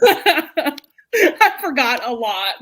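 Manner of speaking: slow, high words through laughter; laughing. Real, not acted. Host admitting to the book club she forgot, laughing slowly. Laughing admission after reread.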